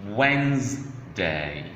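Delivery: "Wednesday" slowly split as "Wens... day"; syllable by syllable. Shown as a demonstration. Teacher breaking the word down for learners, slow split syllables. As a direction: draw out "Wens", then a separate "day". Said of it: "how to pronounce days of the week".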